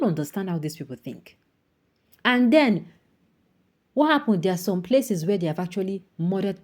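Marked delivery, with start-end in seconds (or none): 2.2-4.4 higher and louder, heated; indignant build. Real, not acted. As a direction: rise into the question. Rising outrage.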